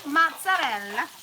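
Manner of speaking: put-on mozzarella in a showy Italian accent. Home cook showing off an ingredient to viewers, Italian accent. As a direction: draw out mozzarella with an Italian flourish. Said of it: "with accents".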